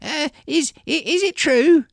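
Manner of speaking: very high, nervous halting question; stuttering. Acted. Villager dares to question the commander, nervous stutter. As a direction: stutter on the first word, high and anxious. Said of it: "Stefan stutters".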